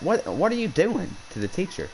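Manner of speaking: high-pitched imitation of shocked friends; incredulous challenge. Classmates questioning what is going on, incredulous.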